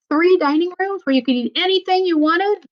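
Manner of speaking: plain reading voice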